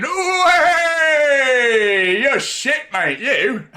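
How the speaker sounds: high, loud mock terrace heckle, laughing at the end; imitating crowd. Mocking crowd heckling a footballer, high loud jeer. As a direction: heckle like a fan who thinks he could do better. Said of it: "as if they could do any better".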